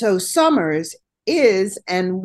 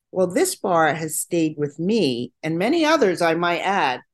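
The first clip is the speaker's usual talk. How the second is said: ordinary talk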